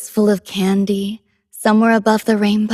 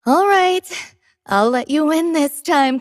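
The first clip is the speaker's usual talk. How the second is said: playful concession; 6 semitones higher than usual. Acted.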